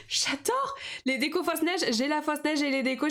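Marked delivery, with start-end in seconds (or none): 0.1-0.8 much higher, delighted "J'adore"; real delight at the decorations; excited squeal. Real, not acted. Gushing over kitsch Christmas stickers.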